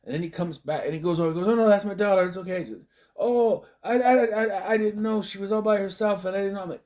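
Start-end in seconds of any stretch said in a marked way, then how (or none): 1.2-6.6 high, flustered stammering mock voice; the man apologizes feebly to the dad; flustered stranger. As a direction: play him as a sheepish excuse-maker.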